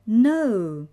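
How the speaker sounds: firm, emphatic no; decided. A narrator's reading. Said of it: "Shaking her head most decidedly".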